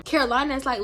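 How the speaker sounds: ordinary talk, nothing marked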